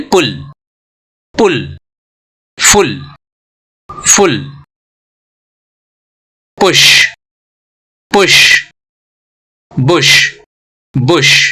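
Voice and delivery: पुल, फुल, पुश, बुश each with a brief u, spaced apart; short vowel drill. Shown as a demonstration. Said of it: "practice करेंगे short vowels का". (पुल, फुल, पुश, बुश में छोटा उ)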